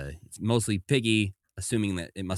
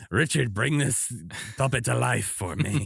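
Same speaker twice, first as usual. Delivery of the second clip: higher-pitched, put-on sculptor voice; Ron Muick; 5 semitones higher than usual. Sculptor begging a performer to animate his creation, put-on voice. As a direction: plead in an imagined eccentric artist's voice. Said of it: "that's what it is right now in my head".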